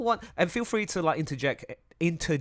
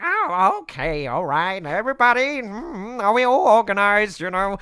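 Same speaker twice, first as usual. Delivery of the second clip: loud, higher, wobbly organizer voice; hammed-up fussy rounding-up of a group; Sano character voice; 7 semitones higher, 9 dB louder than usual. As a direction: call the meeting in a big put-on voice. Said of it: "I just had this voice".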